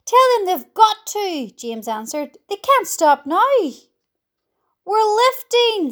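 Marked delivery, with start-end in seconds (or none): 0.0-1.5 high, loud insistence; urgent shouting. A narrator's reading. James ordering the spinners to continue, urgent shouting. 2.5-3.5 high, loud protest; urgent shouting. A narrator's reading. James refusing to let spinners stop, urgent shouting. 4.8-5.9 high, slow excited shout. A narrator's reading. Someone feeling the peach begin to rise, shouting. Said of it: "somebody shouted".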